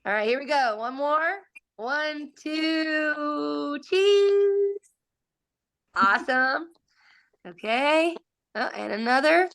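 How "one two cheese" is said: high bright "cheese" for the camera; photo countdown. Real, not acted.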